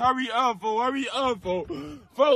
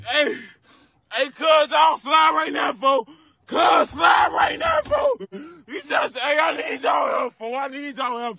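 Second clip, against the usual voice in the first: panicked screaming; 2 semitones higher than usual. Real, not acted. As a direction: yell for your friends in panic.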